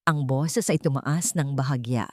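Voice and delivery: ordinary delivery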